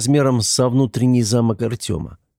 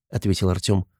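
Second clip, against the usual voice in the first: ordinary delivery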